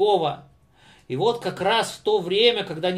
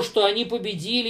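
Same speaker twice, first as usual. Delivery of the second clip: usual speaking voice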